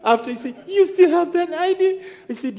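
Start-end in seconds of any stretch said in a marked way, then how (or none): 0.7-2.0 high-pitched incredulous question; retold fright, high and urgent; terrified friend. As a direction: act out a friend's alarm, pitch way up. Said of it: "Vincent was terrified".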